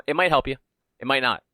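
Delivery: ordinary talk, nothing marked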